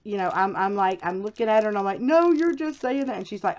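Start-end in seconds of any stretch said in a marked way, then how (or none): 2.0-3.2 higher-pitched mock protest; disbelieving, pitched up; retold protest. Patient doubting the nurse's promise, retold protest.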